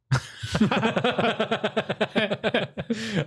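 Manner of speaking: long falling high laugh; bursting laugh. Real, not acted. Laughing at own story.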